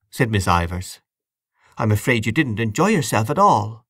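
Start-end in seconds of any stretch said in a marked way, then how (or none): none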